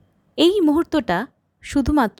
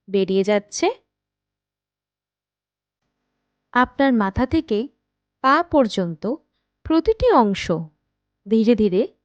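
guided meditation. Acted. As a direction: guide them unhurriedly into rest, phrase by phrase. Leads a guided night meditation. (ধীর লয়ে, থেমে থেমে ধ্যান করানোর কণ্ঠ)

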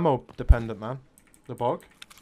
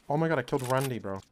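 ordinary delivery

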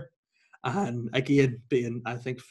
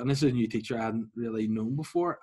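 ordinary delivery

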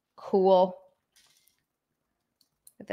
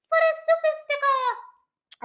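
much higher, odd squeaky put-on voice; silly high voice. Thanking a viewer for a sticker, silly high voice. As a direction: repeat it in a goofy high voice. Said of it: "I'm sorry for saying it like that".

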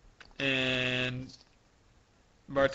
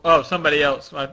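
ordinary talk, nothing marked

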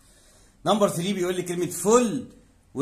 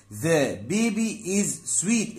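slow teaching read; 3 semitones higher, much slower than usual. Shown as a demonstration. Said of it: "teacher reads English example".

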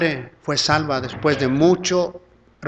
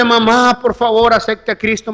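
raised-voice retold plea; begging; 6 semitones higher, 5 dB louder than usual. Son begging his mother to accept Christ, retold pleading. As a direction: implore her, opening high and urgent. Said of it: "y yo rogándole".

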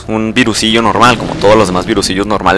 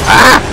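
high, sharp startled yelp; a quick high yelp of surprise; sudden yelp; 5 dB louder than usual. Real, not acted. Sudden outburst mid-sentence.

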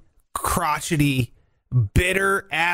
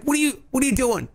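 quick high incredulous voice; lightly voiced disbelief; mock critics; 7 semitones higher than usual. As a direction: fire the question like exasperated fans. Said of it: "They're like".

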